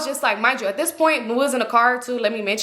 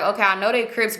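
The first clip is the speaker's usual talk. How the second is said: plain reading voice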